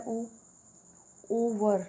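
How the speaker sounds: "ओ" alone, then "ओवर" with a strong long first O; drawn-out O vowel. Shown as a demonstration. Teacher shows the starting vowel of ओवर, held O. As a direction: hit and hold the opening O of "ओवर". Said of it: "इसके तो starting में ही आ रहा है".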